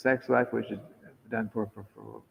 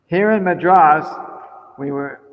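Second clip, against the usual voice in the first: higher, louder reading voice; read-aloud letter; 7 semitones higher, 15 dB louder than usual. Acted. Speaker reading an old letter to the listeners, read-aloud voice. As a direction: read it out loud and clear to a room.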